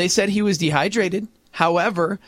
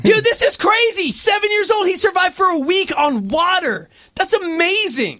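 excited; 9 semitones higher than usual. Real, not acted. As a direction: burst out in amazement. Excited about boy's survival.

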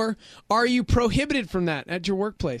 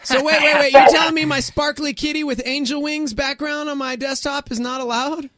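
mock outrage; 6 semitones higher, 5 dB louder than usual.

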